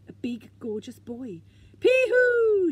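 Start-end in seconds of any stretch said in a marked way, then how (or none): 1.8-2.7 a high, very loud whoop; joyful shout. A narrator's reading. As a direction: holler it across the beach. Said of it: "shouted Moomin Troll".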